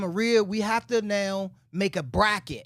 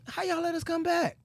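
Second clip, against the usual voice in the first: high, soft plaintive complaint; sad disbelief; 9 semitones higher, 6 dB quieter than usual. Retold or imitated. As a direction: retell it as a hushed, higher-pitched protest. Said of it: "I was kinda sad".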